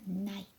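"night" with silent gh and an unreleased final t; weakened final T. Shown as a demonstration. Teacher showing learners how night is read, pronunciation demo. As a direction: model "night" as Korean 나잇, t stopped short. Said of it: "한 단계 약화".

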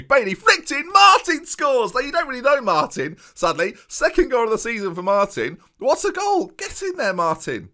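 yelling with joy as his team scores; excited goal call. Real, not acted. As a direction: celebrate the goal like a commentator. Goal scored, commentating celebration.

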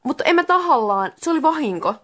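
high-pitched, defensive excuse; ashamed protest. Acted. Santeri excuses the mistake to Miika, ashamed and protesting. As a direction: plead that it was an accident, embarrassed. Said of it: "Santeri sanoi häpäissään".

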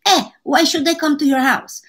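a light hint of an old Italian mother's accent; gently imitating her scolding Italian mom. Daughter imitating her mother's teasing warning, in a light Italian accent. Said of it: "she said".

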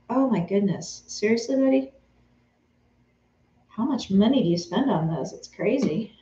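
ordinary talk, nothing marked

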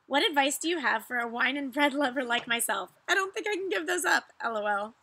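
reading a viewer's letter; reading messages aloud. Acted. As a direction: read it out like a fan message.

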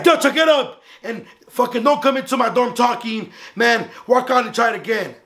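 high-pitched announcing order voice; acted-out reprimand, sustained high; imitated dorm supervisor. Dorm supervisor orders over intercom.

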